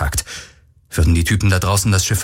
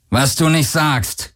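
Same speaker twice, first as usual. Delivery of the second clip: slow, higher-pitched sarcastic growl; 6 semitones higher, slower than usual. A narrator's reading. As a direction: growl it back, drawn out. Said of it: "knurrte Akina". (langsam geknurrt)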